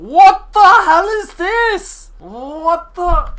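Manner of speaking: high, loud, drawn-out shriek; real high-pitched shock; shocked yell. Real, not acted. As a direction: shriek in utter disbelief. Shocked by what appears on screen.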